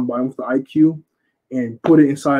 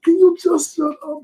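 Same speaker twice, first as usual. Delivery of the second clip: very high exasperated squeak; exasperated Usopp. Retold or imitated. Usopp snapping at Sanji, very high and frantic. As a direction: beg him to shut up, high and frantic. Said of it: "He like".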